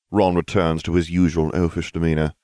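ordinary delivery

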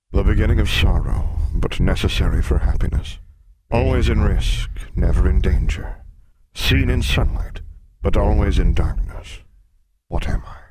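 loud, booming monster voice; riddling sphinx. Acted. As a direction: pose the riddle as a big beast.

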